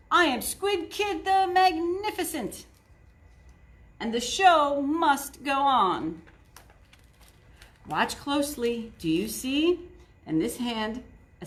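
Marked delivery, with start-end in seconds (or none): 0.1-6.2 a touch of a proud showman; lightly voiced grandiose kid magician; grand stage name. A narrator's reading. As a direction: announce yourself like a star. Kid proclaims his stage name.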